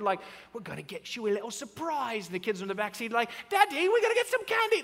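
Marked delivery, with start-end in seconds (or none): 0.5-2.3 put-on, playful secret-keeper voice; imitating a dad's silly surprise voice; weird secret voice. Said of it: "Our voices change. We act all weird". 3.5-4.8 very high, loud mock-kid squeal; hammed-up child voice, high-pitched; weird excited kids. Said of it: "they start acting really weird".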